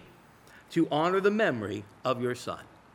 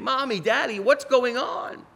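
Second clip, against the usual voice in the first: put-on crying child; 8 semitones higher than usual. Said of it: "crying and saying".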